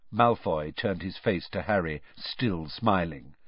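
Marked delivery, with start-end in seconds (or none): none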